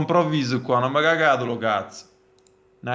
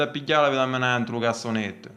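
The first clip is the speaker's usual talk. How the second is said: put-on southern Italian accent. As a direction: sell it like a Naples street vendor. Mock ad in dialect.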